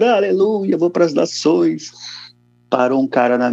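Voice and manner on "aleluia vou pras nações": joyful cry. Retold or imitated. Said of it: "sair do palco chorando".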